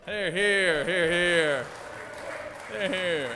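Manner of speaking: hear hear murmur. Real, not acted. Senate chair cheering a speech.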